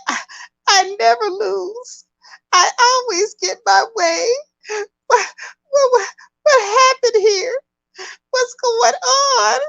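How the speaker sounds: high-pitched hammed-up whiny wail; mock crying. Kim crying because she always gets her way, mock tearful wail. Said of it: "Kim is crying. She's like".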